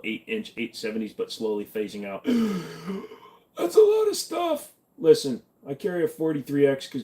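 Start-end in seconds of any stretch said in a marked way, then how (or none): 2.3-3.1 mock gasp. Reacts to long chat message. 3.6-4.7 much higher, louder overwhelmed cry; shocked outburst. Acted. Reacts to long chat message.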